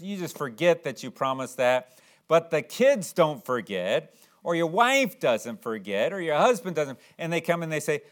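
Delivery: ordinary delivery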